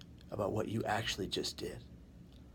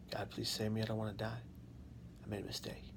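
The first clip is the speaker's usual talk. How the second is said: hushed prayer; 10 dB quieter, faster than usual. Retold or imitated. Retold prayer in the water.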